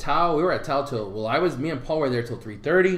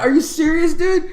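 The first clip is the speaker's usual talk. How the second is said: loud, high-pitched disbelief; incredulous; 14 semitones higher, 7 dB louder than usual. Retold or imitated. Teller replaying his reaction to a friend on the phone, incredulous. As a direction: exclaim it in exasperated disbelief.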